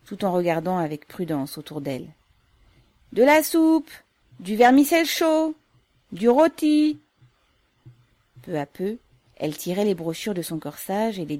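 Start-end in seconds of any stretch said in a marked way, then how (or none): 3.1-7.0 loud, high hawking call; vendor's cry. A narrator's reading. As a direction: call out your wares, lifting each item. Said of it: "la mère criait de temps à autre".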